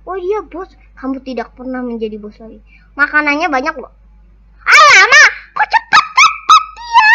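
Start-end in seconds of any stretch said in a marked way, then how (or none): 4.6-7.1 shocked squeal. Real, not acted. Shocked by a fast enemy.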